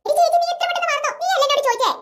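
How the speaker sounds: put-on little girl voice. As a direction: play the confused kid sister, innocent and cheeky. Big sister quizzing little girl.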